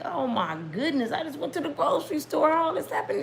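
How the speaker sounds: high-pitched retold exasperation; exasperated retelling. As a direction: act out your exasperation, pitch climbing high. Acts out own exasperated reaction.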